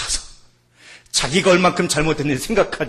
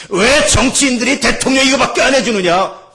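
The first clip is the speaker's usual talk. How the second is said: loud, high mock protest; indignant complaint; 10 semitones higher, 10 dB louder than usual. Preacher voicing citizens let down by politicians, indignant. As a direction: voice an aggrieved citizen's outcry. Voices people's resentful complaint.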